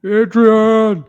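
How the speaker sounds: loud, high Stallone-style bellow of Adrian; Rocky voice. Pretend. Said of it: "But in the Rocky voice?".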